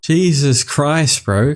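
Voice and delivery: ordinary talk, nothing marked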